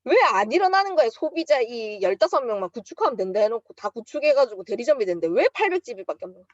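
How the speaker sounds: acting out fed-up ranting; retold angry venting. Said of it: "너무 열받는 거야".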